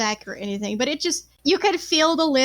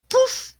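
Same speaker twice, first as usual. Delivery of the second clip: high poof; 12 semitones higher than usual. Acted. Toy collapsing sound effect.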